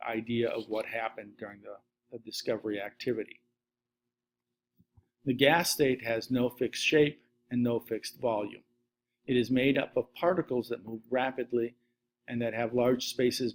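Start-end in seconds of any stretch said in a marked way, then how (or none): none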